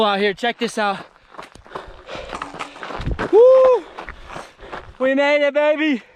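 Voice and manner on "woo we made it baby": high whooping summit cheer; excited cheer. Real, not acted. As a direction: shout it out in triumph. Reaching the summit.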